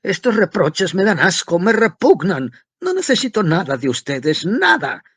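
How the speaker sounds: high tenor shout. A narrator's reading. Student screaming at his mother in his highest tenor, loud. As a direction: scream it at the top of your voice. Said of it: "se puso a gritar con la nota de tenor más alta".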